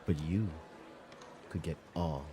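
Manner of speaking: low quiet mysterious voice. Acted. Narrator voices tempting inner voice.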